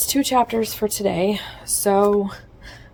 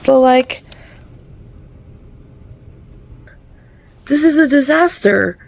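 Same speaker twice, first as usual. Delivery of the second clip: exasperated outburst; 7 semitones higher, 10 dB louder than usual. Real, not acted. Exasperated at her messy edits.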